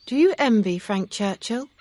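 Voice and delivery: teasing him toward the truth; Emma, curious. A narrator's reading. Emma asks pointed question.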